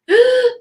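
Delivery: high loud wheezing gasp; strider gasp. Shown as a demonstration. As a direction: imitate the dog's gasp. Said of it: "when you hear that strider going".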